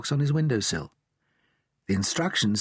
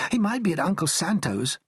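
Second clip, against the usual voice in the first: hopeful Billy; 7 semitones higher than usual. A narrator's reading. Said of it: "said Billy hopefully".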